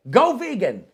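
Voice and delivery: punchy cheer with a high jump in pitch; lightly shouted slogan; mock team cheer. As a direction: call it out like a football chant. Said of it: "not a bad cheer".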